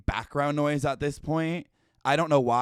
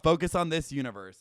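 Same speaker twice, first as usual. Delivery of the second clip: normal talking voice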